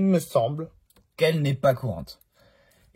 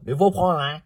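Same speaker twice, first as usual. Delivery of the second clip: put-on loud, higher-pitched burst of Ch'ti accent; 5 semitones higher, 6 dB louder than usual. Collector defending a damaged car, Ch'ti accent. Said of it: "je fais mon accent, euh, ch'ti".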